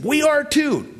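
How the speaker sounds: very high, eager insistence; mock-enthusiastic chorus reply; eager crowd. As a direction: pipe up as the keen followers. Acts out the people's eager reply.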